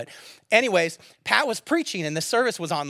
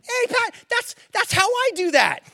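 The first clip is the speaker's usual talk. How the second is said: much higher, louder, playful mock protest; mock indignant; 12 semitones higher, 6 dB louder, slower than usual. Pastor hearing his former student preach, mock outraged and high-pitched. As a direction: squeal in mock outrage that someone stole your line. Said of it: "And I was like".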